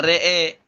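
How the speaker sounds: RE'E with both vowels tilted to e; imalah at a stop. Shown as a demonstration. Teacher showing the reading when pausing, imalah in RE'E. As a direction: end "re'e" on a long e. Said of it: "Waqfan imala fathatur ra wal hamzah".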